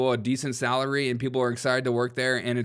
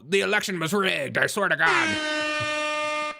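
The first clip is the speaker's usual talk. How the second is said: hammed-up protest; 5 semitones higher, much slower than usual. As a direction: wail it ever higher and longer. Acted character protesting the lost election.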